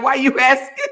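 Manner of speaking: laughing mock retort. Host imagining her own objection, breaking into laughter. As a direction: question dissolving into giggles.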